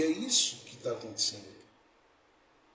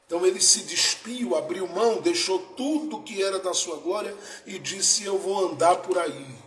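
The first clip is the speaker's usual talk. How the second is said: loud, high-pitched preacher's surge; forceful preaching; 6 semitones higher, 6 dB louder than usual. Real, not acted. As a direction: proclaim it with full pulpit force. (pregação vigorosa, em voz alta)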